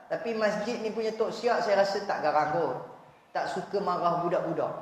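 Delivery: normal talking voice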